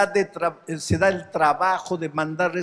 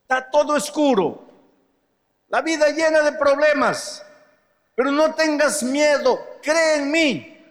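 higher, louder, gently urging Jesus voice; preacher lightly voicing Jesus's comfort; 6 semitones higher, 4 dB louder than usual. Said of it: "Ahora viene Jesús y dice".

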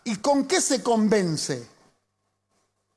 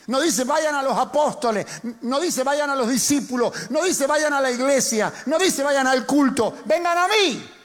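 preaching; 5 semitones higher than usual. Acted. Pastor preaching to the congregation, rising and emphatic. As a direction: drive the point home to the congregation.

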